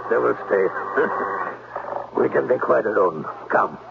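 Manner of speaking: put-on German accent. German-accented Klemper lures the governess to the gazebo. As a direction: charm her in a heavy German accent.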